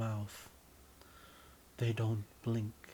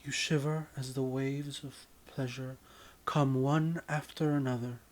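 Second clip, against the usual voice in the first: plain reading voice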